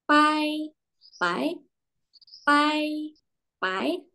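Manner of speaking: Pai alternating high level and rising; teacher alternates tones on Pai; tone contrast drill. Shown as a demonstration. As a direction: switch Pai between first and second tone. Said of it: "antara nada satu dengan nada dua".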